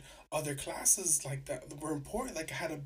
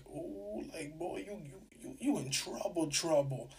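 teasing ooh. Retold or imitated. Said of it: "everybody in that little office".

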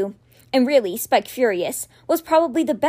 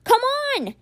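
frustrated plea; 13 semitones higher than usual. A narrator's reading. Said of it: "he tried a third time".

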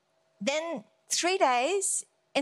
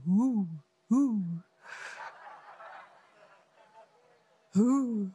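hooting "who" like an owl; wise owl; 5 semitones lower, much slower than usual. Retold or imitated. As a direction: draw out each who as a hoot. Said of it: "Be like the wise owl".

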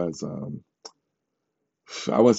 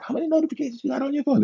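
much higher, rushed exasperated put-on voice; mock Shalia voice; 3 dB quieter, much faster than usual. Imitating Shalia nagging him about his phone, high and fast. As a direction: rattle it off in a high mocking squeal. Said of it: "she's like".

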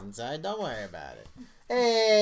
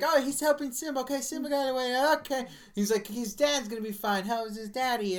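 imitating a little kid; 9 semitones higher than usual. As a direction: play the hopeful little boy watching the movie.

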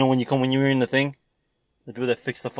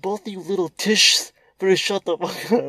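high, slow squeaky warning; high tape voice; 8 semitones higher, 4 dB louder than usual. Retold or imitated. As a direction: squeak out a threat. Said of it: "He's like".

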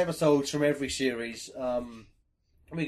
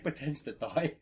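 ordinary talk

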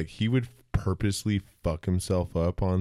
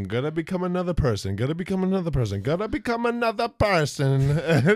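high, fast, rising chant; Master of Disguise voice; 10 semitones higher than usual. Retold or imitated. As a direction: repeat it faster and faster, frantic. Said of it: "he's like".

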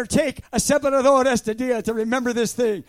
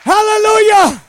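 loud, high hallelujah; shouted hallelujah; 6 semitones higher, 11 dB louder than usual. Real, not acted. Preacher crying out praise to the congregation, loud and high. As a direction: throw the praise up to heaven.

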